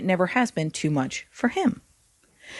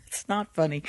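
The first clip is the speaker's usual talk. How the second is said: ordinary delivery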